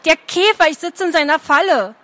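stamp and snap it out; the trapped girl, furious; angry girl. A narrator's reading. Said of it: "stampfte wütend".

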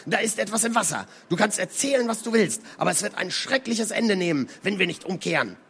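viel höher, hastig und verängstigt; aufgebrachter Seemann. Acted. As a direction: die anderen zur Umkehr drängen. Toke drängt vor, warnt. (ängstlicher, hoher Hitzkopf)

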